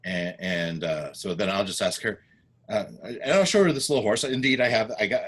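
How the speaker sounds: normal talking voice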